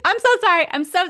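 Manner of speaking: high, hurried mock-panicked sorry; acted-out frantic backpedalling; flustered apology. Acting out her panicked apology.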